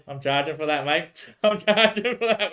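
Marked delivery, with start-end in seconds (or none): 1.3-2.5 higher, slow, through a laugh; giggling the joke again. Real, not acted. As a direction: laugh while repeating it. Repeats line laughing.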